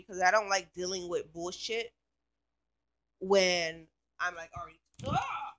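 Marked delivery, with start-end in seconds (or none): 5.1-5.5 real startle. Real, not acted. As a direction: caught off guard by a bug. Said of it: "huge fucking mosquito that landed on me".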